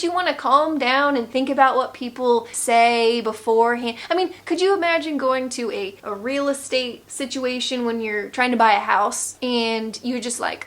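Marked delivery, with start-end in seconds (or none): none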